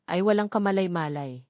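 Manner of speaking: ordinary delivery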